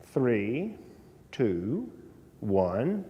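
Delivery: soft, measured count under tension; silent countdown, voiced. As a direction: count down softly as if inside your head. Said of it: "count down in my head".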